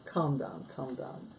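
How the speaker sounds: ordinary talk, nothing marked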